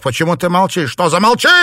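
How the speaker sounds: loud, high, raging demand; furious yell. A narrator's reading. Husband roaring at his silent wife, furious yell. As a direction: bellow it at her, beside himself. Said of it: "заорал он вне себя".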